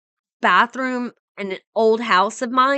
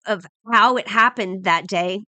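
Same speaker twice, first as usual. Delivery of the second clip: ordinary talk, nothing marked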